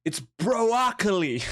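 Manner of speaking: high, slow goofy reveal of broccoli; Chris D'Elia bit. Acted. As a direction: draw out broccoli like a punchline. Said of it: "I've been watching too much Chris D'Elia".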